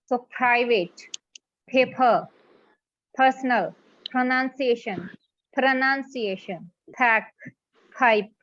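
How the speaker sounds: each p puffed, "paper" said as pha-pher; aspirated p words. Shown as a demonstration. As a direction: punch the breath on every p. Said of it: "Similarities है इसमें".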